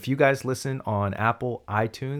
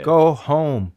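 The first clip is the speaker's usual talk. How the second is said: loud, higher-pitched call through the door; mock shouted order; 5 semitones higher, 8 dB louder than usual. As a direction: send a kid away with a raised voice. Pretends shouting through doorbell at kid.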